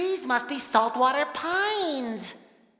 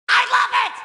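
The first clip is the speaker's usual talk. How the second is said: loud, very high cartoon squeal; overjoyed high cry; delighted shout; 11 semitones higher, 7 dB louder, much faster than usual. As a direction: shout it out, every word punched. Tourist cheers at the sights.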